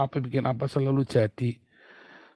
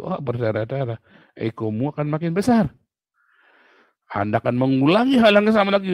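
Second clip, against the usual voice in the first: louder, driving sermon voice, building to a peak; fiery preaching; 3 semitones higher, 4 dB louder than usual. Acted. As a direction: hammer the warning home to the congregation. Preaching at full force.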